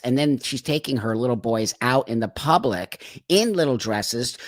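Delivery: ordinary talk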